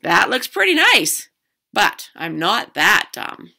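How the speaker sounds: admire, then refuse slowly; tempted but firm. Acted. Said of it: "said Cheryl".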